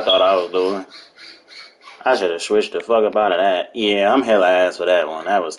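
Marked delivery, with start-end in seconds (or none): none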